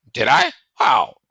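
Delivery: sudden high, loud surprise; surprised. Acted. Bear startled by the boy's news.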